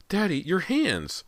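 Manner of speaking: high, slow alarmed cry. A narrator's reading. Sister alarmed.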